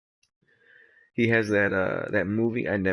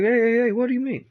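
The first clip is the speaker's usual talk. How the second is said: high, loud defensive protest; mock protest; 14 semitones higher, 6 dB louder, faster than usual. Conan defending his ape, high loud mock protest. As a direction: jump in to defend. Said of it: "Cole's like".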